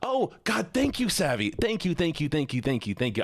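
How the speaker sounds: high, gushing burst of gratitude; excited, relieved thank-yous. Real, not acted. Sudden burst of gratitude to viewer.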